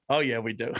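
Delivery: low, matter-of-fact admission; deadpan Canadian. Retold or imitated. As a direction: own it flatly, no shame. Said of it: "And he just goes".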